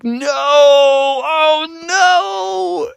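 high, very drawn-out moan; hammed-up long, high lament; dread wail. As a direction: wail it slowly in mock horror. Froggy dreads dad coming.

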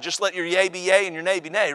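normal talking voice

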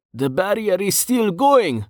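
high-pitched, slow, put-upon character voice; agitated Brovos. Acted. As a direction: answer high and defensive, drawing it out.

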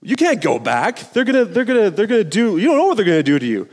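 pressure the cub with mock alarm; urgent Scar. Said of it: "and his uncle's like".

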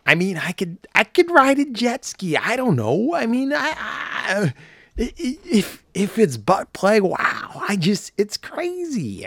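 a hoarse, half-voiced Owen Wilson musing; Owen Wilson impression. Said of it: "I'm gonna try to do Owen Wilson".

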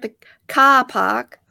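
slow New York accent, R's dropped in "car park". Shown as a demonstration. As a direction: demonstrate New York vowels, stretched out. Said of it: "the New York".

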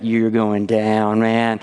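lightly voiced menacing big kids; tough older kids. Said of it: "standing there like".

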